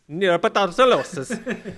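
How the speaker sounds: slow, laughing Italian-tinged Neurobiotinosis; defending the scientific pronunciation with a laughing Italian lilt; mock Italian accent. Shown as a demonstration. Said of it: "That's how it's pronounced".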